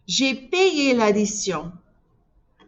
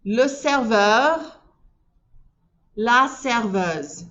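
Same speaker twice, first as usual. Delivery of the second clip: slow teacher model; much slower than usual. Shown as a demonstration. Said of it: "Say it after me".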